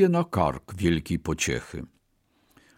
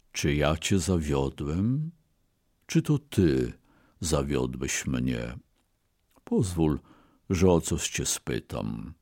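low, soft, sorrowful questioning; sad; 6 semitones lower, 4 dB quieter than usual. A narrator's reading. Wronged ox asking his master who failed whom, sadly. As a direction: ask it gently, hurt and subdued. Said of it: "powiedział ze smutkiem".

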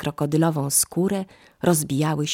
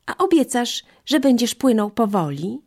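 high, louder pleading question; anxious monkey; 8 semitones higher, 5 dB louder than usual. Acted. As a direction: make him promise, voice up high. Monkey asks for promise.

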